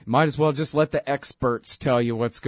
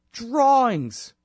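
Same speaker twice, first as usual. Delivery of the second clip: disbelief; 8 semitones higher than usual. Real, not acted. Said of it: "And drawings".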